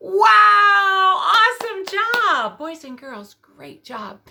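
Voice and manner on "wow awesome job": high loud thrilled cheer; excited praise. Real, not acted. Teacher cheering the class after they shout, thrilled and loud.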